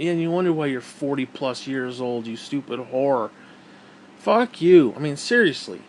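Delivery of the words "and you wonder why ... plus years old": normal talking voice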